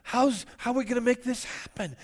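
high, loud mock panic; panicked worrier. Preacher mimics a believer panicking over needs. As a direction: throw up your hands, hammed-up fret. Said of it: "And you go".

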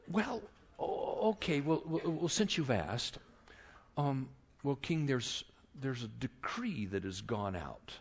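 soft, halting, full of false starts; hesitant confession. Acted. Esther broaching the decree to the king, hesitant and soft. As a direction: ease into bad news carefully.

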